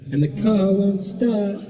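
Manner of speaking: a sound engineer bellowing the lyric; imitating the sound engineer. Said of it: "he's like".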